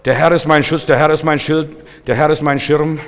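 normal talking voice

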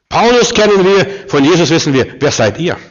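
acted demon confronting impostors; possessed man. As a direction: start high and loud, then ease a little. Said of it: "steht der Besessene auf und sagt".

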